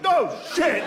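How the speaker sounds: sudden high startled no shit; panicked fighter caught off guard; panicked shout. Acted. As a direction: yelp it high in shock.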